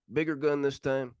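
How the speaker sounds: ordinary talk, nothing marked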